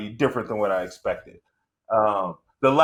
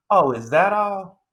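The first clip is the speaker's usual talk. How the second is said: mock mom; 8 semitones higher, 5 dB louder, slower than usual. Mom's calm reply, mimicked.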